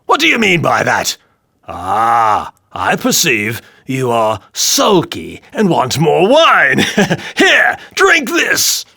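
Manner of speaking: king bullying the dwarf with wine; loud taunting king. A narrator's reading. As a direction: force the drink on him. King voiced in story.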